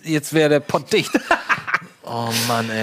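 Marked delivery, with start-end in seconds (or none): 1.2-1.8 a quick airy laugh; amused at own mistake; short breathy laugh. Real, not acted. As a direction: laugh it off lightly.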